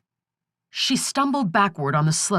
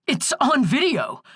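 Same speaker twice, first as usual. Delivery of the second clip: cried out; 7 semitones higher than usual. A narrator's reading. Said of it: "cried Skip".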